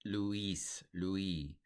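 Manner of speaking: "Luis" as loo-EES, "Louis" as loo-EE; soft, long "ee" in "Luis, Louis"; "ee" pronunciation. Shown as a demonstration. Teacher modelling the original sound, ee pronunciation. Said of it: "it was pronounced more E".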